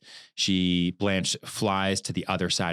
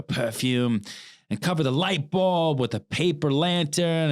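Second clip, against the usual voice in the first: put-on higher, slow, sneering drunk Stanley; mock the woman from the top of a drunk's voice; Southern brute taunting Blanche, pitched up and drawn out; 8 semitones higher, 3 dB louder than usual. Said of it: "and Stanley says".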